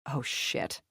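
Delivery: hushed, breathless whisper; unvoiced whispered curse. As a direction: whisper it in dread. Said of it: "Nicole whispered".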